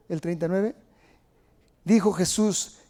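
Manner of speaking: usual speaking voice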